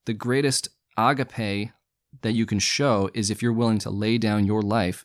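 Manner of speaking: normal talking voice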